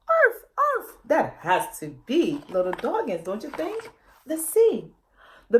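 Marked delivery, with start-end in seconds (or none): 0.1-1.0 two high squeaky puppy yips; puppy barks. A narrator's reading. Lost puppy calling louder to the family, high yips. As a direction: yip like a little pup calling back. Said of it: "they heard it a little louder".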